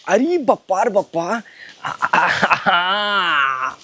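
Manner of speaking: long, low groans of discomfort; moaning. Acted. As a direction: moan it out, drawn-out and low.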